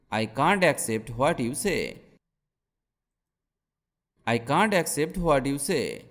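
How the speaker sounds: ordinary talk, nothing marked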